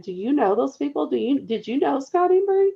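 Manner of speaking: high, pointed confronting questions. Retold or imitated. To the gossiping woman, pointed high confronting questions. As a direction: press her with sharp questions.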